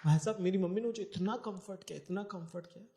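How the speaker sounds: normal talking voice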